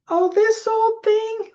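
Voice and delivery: high, brushing-off voice; mock deflecting. Answering a compliment, dismissive and high. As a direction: wave off the compliment in a high squeak. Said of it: "Did you say".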